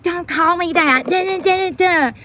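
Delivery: high mocking squawk; mocking rant. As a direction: parody the cashier's ranting. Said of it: "He's like".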